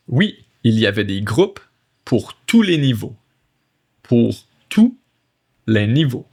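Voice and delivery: French read in separate phrases with pauses; chunked reading. Shown as a demonstration. Teacher reading French to learners, phrase by phrase. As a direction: break the sentence up for learners.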